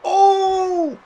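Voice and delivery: loud high gasp of excitement; excited shout. Real, not acted. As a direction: shout at the big hit. Reacting to a game move.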